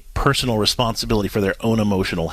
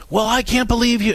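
higher mock indignant husband; mock outraged husband; 11 semitones higher than usual. Husband protesting his wife's boundary, mock outraged and higher. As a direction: play his outrage, pitched up.

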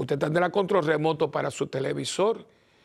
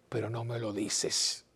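soft reproach; 5 semitones lower, 7 dB quieter, slower than usual. Retold or imitated. Wife answers her husband quietly.

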